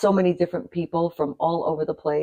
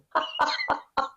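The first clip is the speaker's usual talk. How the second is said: embarrassed laugh; 10 semitones higher, 5 dB quieter than usual. Real, not acted. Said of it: "never mind".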